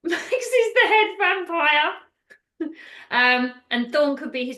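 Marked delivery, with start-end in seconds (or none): 0.0-1.9 high, drawn-out announcement; mock dramatic reveal. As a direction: draw out the big reveal high. Mock reveal of film twist.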